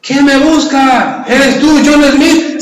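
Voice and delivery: high-pitched acted call, climbing at the end; shouting back. Mexicans answering the gringos' trick, shouting back in high voice. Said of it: "Y le gritan los mexicanos".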